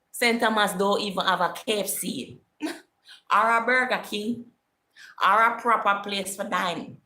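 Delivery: high, loud, amused exclaiming; incredulous ridicule. Real, not acted. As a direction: scoff at the place with a laugh. Ridiculing hometown's lack of amenities, laughing.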